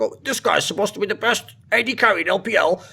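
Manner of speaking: imitating scoffing trash talk; mock critics' quote. Mocking quote of trash-talking critics.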